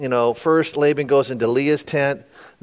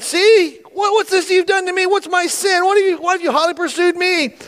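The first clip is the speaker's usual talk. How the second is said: high-pitched, heated acted tirade; angry rebuke; 3 dB louder than usual. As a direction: fire indignant questions at him. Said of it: "Then Jacob was angry and rebuked Laban".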